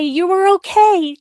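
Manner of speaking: fighting back real tears; getting emotional. Real, not acted. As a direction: voice rising and catching as she gets emotional. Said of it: "didn't think I was gonna get emotional".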